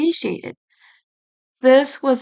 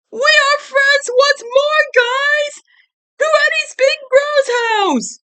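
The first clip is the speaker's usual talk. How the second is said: joyful cheer. Acted. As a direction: boom it out with glee. Ed cheers, picking them up.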